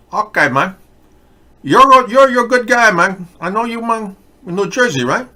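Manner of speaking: put-on high, loud Cuban-accented guy. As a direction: play it as a Miami Cuban tough guy. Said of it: "Your Cuban accent is pretty good".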